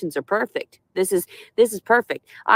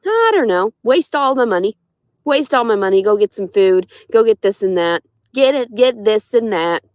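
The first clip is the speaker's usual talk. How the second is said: mock carefree reply; 2 semitones higher, 3 dB louder than usual. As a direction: play the careless spender they imagine. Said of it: "It's like".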